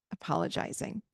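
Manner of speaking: normal talking voice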